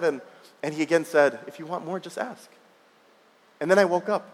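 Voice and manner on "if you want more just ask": put-on soft, gentle old man's reply; a quiet, smiling old man in a dream; gently, with a smile. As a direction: answer softly, kind and amused. Old man repeats gentle answer.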